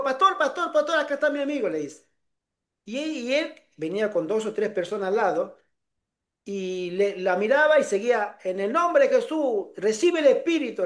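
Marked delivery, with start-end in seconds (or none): 0.0-1.7 high, eager retold call-out; calling from afar. Friend hailing the pastor from afar, eager mimicked call. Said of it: "Entonces ella de lejos". 8.4-10.9 imitated faith healer, pitched up; fervent preacher. Pastor laying hands on worshippers, mimicked fervent invocation.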